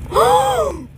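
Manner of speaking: high, loud gasp of alarm; shocked gasp. Pretend. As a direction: gasp as if the toy just got hurt. Toy falls, child reacts.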